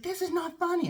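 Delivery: acted-out indignation in a high pitch; indignant scolding. Boss telling the laughing employee off, indignant.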